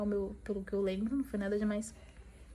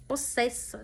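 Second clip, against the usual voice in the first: ordinary delivery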